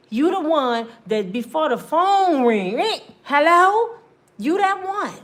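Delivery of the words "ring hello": loud, high, slow eager hello. Retold or imitated. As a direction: grab the phone and sing hello. Woman eagerly answering phone.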